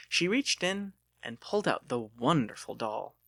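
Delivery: usual speaking voice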